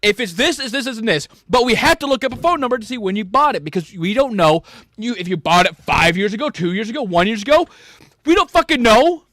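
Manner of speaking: retold argument rising to a shout on the last line; angry retold reply. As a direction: snap back at a screaming caller. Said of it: "and I say".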